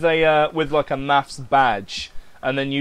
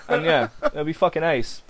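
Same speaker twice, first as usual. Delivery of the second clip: ordinary talk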